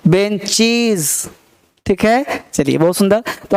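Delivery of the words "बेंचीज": very slow "बेंचीज" with long ee; plural IZ ending. Shown as a demonstration. Teacher showing class the plural, slow "बेंचीज" with z. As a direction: say "बेंचीज", drawing out -cheez. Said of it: "बेंच का बेंचीज".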